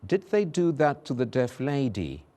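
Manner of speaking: careful d and th in "Did they do that to the deaf lady"; tongue twister demo. Shown as a demonstration. Teacher reading the d and th practice sentence. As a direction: separate every d and th. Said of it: "We have".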